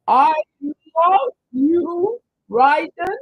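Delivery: very high, slow, loud squeal of love; high gushing coo. Pretend. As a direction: coo it like to a baby. Gushing at Ryden in put-on voice.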